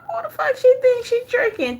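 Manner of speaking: high, disbelieving squeal; incredulous. Retold or imitated. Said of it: "I'm like".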